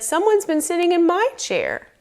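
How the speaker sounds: high-pitched mama bear voice. A narrator's reading. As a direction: voice a mother bear, pitched well up. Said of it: "so Mama said".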